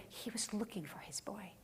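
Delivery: hushed, soft whisper. Real, not acted. Preacher telling the congregation of the father's longing, whispered. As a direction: breathe it out tenderly. Tender climax of sermon.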